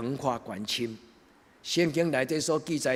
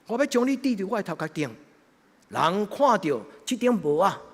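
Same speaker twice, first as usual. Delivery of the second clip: preaching; 7 semitones higher, 7 dB louder than usual. Acted. Preaching at full force.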